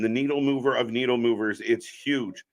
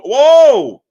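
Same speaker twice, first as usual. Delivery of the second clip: high, loud burst of surprise; surprised whoa; 13 semitones higher, 14 dB louder than usual. Real, not acted. Watching the cage match with chat, loud surprised whoa. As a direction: yelp at the big spot. Reacting to cage action live.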